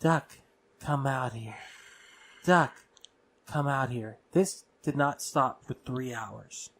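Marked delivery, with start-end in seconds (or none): 0.0-4.2 lightly voiced eerie summons; imitated parent's voice calling. Retelling voices heard in a dark room, imitated calling. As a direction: repeat it as a measured call, pausing after the name. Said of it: "it was my parents' voice saying it".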